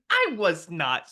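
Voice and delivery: high, loud, drawn-out mock denial; hammed-up indignant squawk; mom's indignant protest. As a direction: protest like an outraged mom, stretched out. Mom's indignant comeback to joke.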